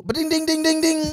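high loud ding-ding yelps; howled gunshot noises. Retold or imitated. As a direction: yelp the gunshot sounds way up high.

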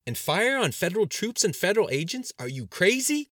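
high, loud incredulous outburst; loud disbelief. A narrator's reading. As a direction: demand it as if appalled. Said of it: "shook his head in disbelief".

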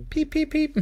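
high squeaky peep peep peep; baby chick peeps. Retold or imitated. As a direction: piep zoals een kuikentje. Imitating baby turkey sounds.

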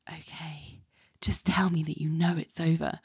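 unvoiced, soft whisper. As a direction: breathe it out as a whisper. Jamie whispering in tender scene.

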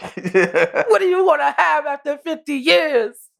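slow, laughing mock superhero father; a father lecturing his son, in a slow mock-grave voice; Omni-Man. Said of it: "sitting there with the Omni-Man pose".

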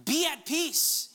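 a stern call to be at peace; commanding. Shown as a demonstration. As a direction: command it, syllable by syllable. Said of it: "he's commanding and telling them".

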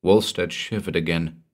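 usual speaking voice

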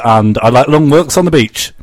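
ordinary delivery

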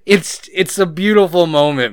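normal talking voice

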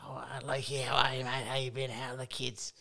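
lightly voiced friendly old footy coach; a hint of a chummy veteran coach; John Reid impression. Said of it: "Can you do a Reedy impression for me?".

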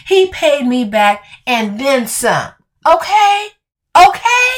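from slow and firm to a high, loud yelled okay; building to a shout. Acted. Escalating defensive repetition.

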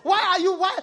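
high, loud, imploring mock cry; retold shout. Visitor to preacher on meeting so late, acted shout. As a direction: demand it loudly as the visitor.

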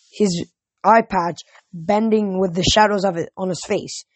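ordinary delivery, nothing marked

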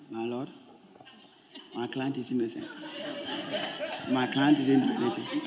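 softly pleading his client's case; hushed, mock-polite courtroom plea; quiet mock lawyer. Pastor mimics a lawyer speaking softly in court, hushed and laughing. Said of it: "A lawyer is arguing his case".

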